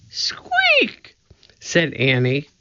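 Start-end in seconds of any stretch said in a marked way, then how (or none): 0.1-1.1 very high-pitched pretend mouse; piping mousy squeal; mouse squeak. A narrator's reading. Girl demonstrates a mouse squeak.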